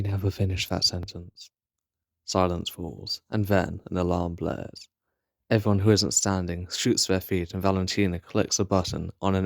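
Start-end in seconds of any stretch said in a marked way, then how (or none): none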